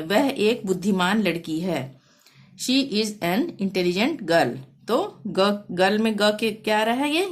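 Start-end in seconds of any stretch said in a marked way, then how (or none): 2.6-4.6 very slow, each word spaced out; slow dictation. Shown as a demonstration. Teacher reading an example sentence to pupils, slow and clear. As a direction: read it out slowly for the class.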